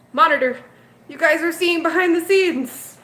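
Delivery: thrilled reveal to the viewers; excited. Real, not acted. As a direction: blurt it out thrilled. Camera flips to show surprise view.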